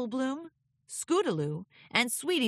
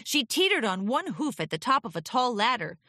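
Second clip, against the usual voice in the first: usual speaking voice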